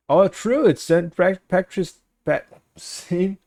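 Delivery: bursts out high and loud as it clicks; suddenly remembering, excited; oh right, it's today; sudden realization. Real, not acted.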